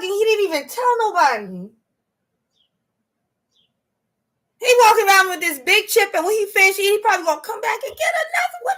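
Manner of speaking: incredulous, squealing amusement; amazed realization. Real, not acted. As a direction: marvel at the sneaky squirrel. Amazed realization about squirrel.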